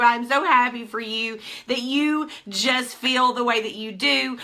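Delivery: normal talking voice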